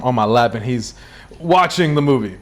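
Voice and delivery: ordinary delivery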